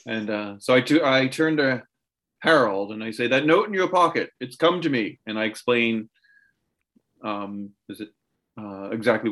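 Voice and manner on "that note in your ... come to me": in-character warning. Acted. Player voices Quentin to Harold.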